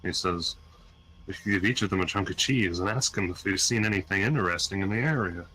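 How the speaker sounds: ordinary talk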